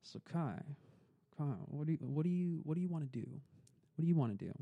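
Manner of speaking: a hint of an old man pressing gently; grandfather's voice. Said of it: "his grandfather sits us down".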